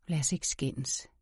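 low, soft, slow hoarse plea; understated hoarseness, slow and quiet. A narrator's reading. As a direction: make peace in a hushed, husky voice. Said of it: "Hans stemme er hæs".

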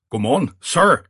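high, loud, startled greeting. A narrator's reading. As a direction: greet the superior loudly, high-pitched. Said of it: "sagde med høj stemme". (med høj stemme)

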